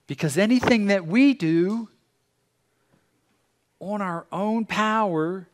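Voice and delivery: high, drawn-out, forceful proclamation; fervent preaching. Real, not acted. Pastor urging congregation to tap into God, fervently. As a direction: preach it with rising fervor.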